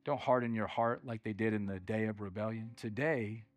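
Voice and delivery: low, soft, earnest pleading; solemn appeal. Real, not acted. Preacher closing with an appeal to act today, hushed and solemn. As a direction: bring it right down and urge them gently.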